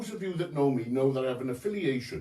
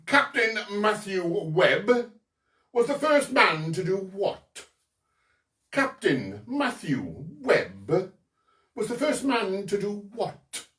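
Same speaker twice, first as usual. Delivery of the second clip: put-on Cowbridge accent; 3 semitones higher than usual. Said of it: "This is my Cowbridge accent".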